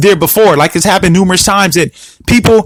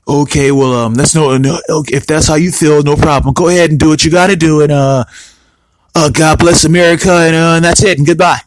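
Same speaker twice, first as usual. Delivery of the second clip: low, resigned imitation; Donald Trump; 6 semitones lower than usual. Imagined president replying to prime minister's refusal, low mock resignation. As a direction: voice a president giving in, pitched well down. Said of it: "here's what Trump said in reply".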